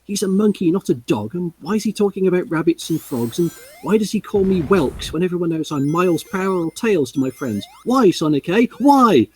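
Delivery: fussy Tails, building to a squawk; exasperated Tails. Acted. Tails complains to Sonic about the monkey's slang, rising to a whiny shout. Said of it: "hissed Tails".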